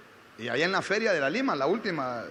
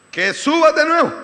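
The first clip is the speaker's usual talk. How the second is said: loud, high proclamation; retold prophetic command; 9 semitones higher, 13 dB louder than usual. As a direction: deliver God's order forcefully to the singer. Girl delivers God's message, retold. (proclamación profética, fuerte y aguda)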